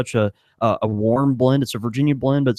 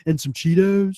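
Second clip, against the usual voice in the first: hippie voice; 7 semitones higher than usual. Retold or imitated. Stoner customer joke.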